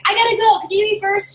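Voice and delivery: high, loud, urgent request; pressing, high-voiced plea; hurried guest. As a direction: pipe up eagerly to jump the line. Acting a guest in a hurry.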